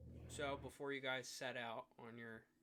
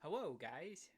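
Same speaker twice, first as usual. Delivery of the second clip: Elmer Fudd voice; 3 semitones higher, 13 dB louder, faster than usual. Pretend. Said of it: "voiced like Elmer Fudd".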